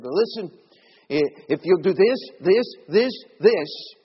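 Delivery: loud, mocking imitation of a pushy preacher; hammed-up mock sales pitch for salvation; sarcastic. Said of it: "I'm being a little sarcastic".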